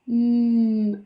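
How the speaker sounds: ordinary talk, nothing marked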